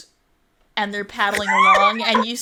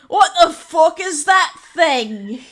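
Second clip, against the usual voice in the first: loud, higher, slow gruff pirate shout; pirate yelling; 8 semitones higher, 9 dB louder than usual. Acted. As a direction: bellow it across the deck at the freak. Pirate points and yells at stranger.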